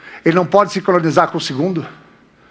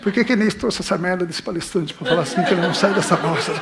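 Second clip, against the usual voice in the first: much softer mock grumble, half laughing; laughing mock complaint under the breath; bored grumbling; 8 dB quieter than usual. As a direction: mutter like a fed-up student. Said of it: "Eu falar".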